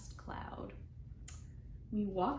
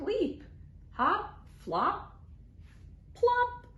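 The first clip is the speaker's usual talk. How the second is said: playful frog leaps; 12 semitones higher, slower than usual. A narrator's reading. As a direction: bounce the words up like a frog. Frog leaping sounds in story.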